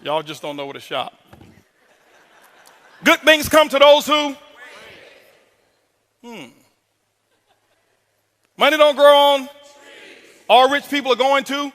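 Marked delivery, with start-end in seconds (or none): 3.0-4.3 "who" left hanging, loud and high, for the audience to finish; louder, higher cue ending open on "who"; prompting the crowd. Real, not acted. 8.6-11.7 sayings left hanging high on "on" and "to" for the audience; higher call-out, stopping open on "on" and "to"; prompting the crowd. Real, not acted.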